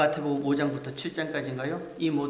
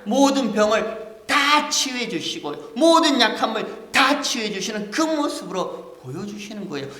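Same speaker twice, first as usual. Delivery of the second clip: loud, high, impassioned preaching; full-force preaching; 7 semitones higher, 10 dB louder than usual. Acted. Pastor preaching to the congregation, loud and impassioned. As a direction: drive the point home to the congregation.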